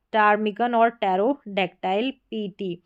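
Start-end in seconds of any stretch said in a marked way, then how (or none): none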